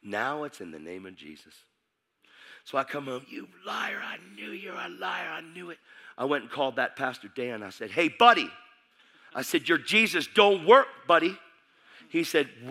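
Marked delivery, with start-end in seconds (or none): 3.3-5.8 mock strained high shriek, held down in volume; angry accusation. Girlfriend's acted accusation at homecoming. 7.9-8.5 loud, high call-out down the phone; angry confrontation. Retold or imitated. Retold angry phone call to pastor. 9.7-11.4 angry confrontation. Retold or imitated. Retold angry phone call to pastor.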